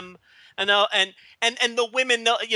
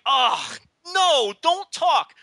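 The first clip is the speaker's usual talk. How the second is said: mock exasperated plea; 5 semitones higher, 3 dB louder, much slower than usual. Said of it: "I'm just like".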